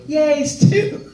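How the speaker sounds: high, sweet character cheer; Maxie's expected cheer. Retold or imitated. Imitating the line expected from the new Al, high innocent cheer. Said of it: "expected to hear her go".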